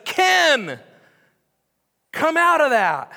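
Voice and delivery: high, loud calling out a name; loud summons. Acted. Jesus calls Ken out of his struggle, loud call. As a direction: shout him out of his grave.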